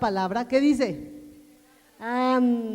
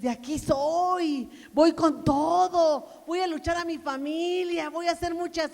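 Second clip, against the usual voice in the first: overconfident believer; 5 semitones higher, 3 dB louder than usual. Retold or imitated.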